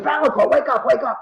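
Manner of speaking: pitched high, urgent, calling out to wake him. Retold or imitated. To the sleeping passenger as the double passes, urgent high call. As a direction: shake him awake with your voice, high and urgent.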